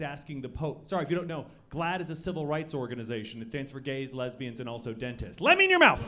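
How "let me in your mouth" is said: high, loud leering dentist; creepy dentist voice. Pretend. Mock dentist leering at a patient, high and loud. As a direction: squeak it like a pervy dentist.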